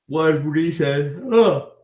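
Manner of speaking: stretched words in a real yawn. Real, not acted. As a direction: yawn through the start of the sentence. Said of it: "excuse me, one reason".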